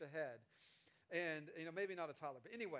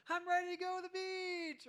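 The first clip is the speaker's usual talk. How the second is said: put-on much higher, loud childish voice; eager toddler; 10 semitones higher, 7 dB louder than usual. Toddler waking up ready for the beach, childish. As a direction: bounce like an impatient little kid. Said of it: "they go".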